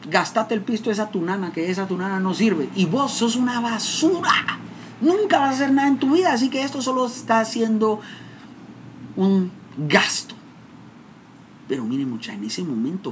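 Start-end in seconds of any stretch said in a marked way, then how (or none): 0.1-10.3 high, contemptuous father scolding, slowing at the end; father's contempt. Retold or imitated. Father telling his son he is worthless. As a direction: sneer it down at the son. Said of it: "mi papá se entera y me dice".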